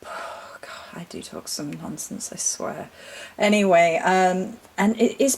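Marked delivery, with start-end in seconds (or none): 0.0-2.9 whispered aside. Real, not acted. Self-mocking aside after tangent.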